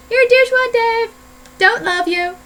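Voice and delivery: high, loud mock-angry shout; playful yell. Vlogger calling out mom's ex, mock yell. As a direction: yell it out as a comic taunt.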